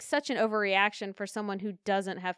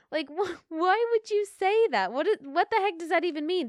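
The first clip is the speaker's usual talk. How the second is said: exasperated; 8 semitones higher than usual. Real, not acted. Outraged at F-boy logic.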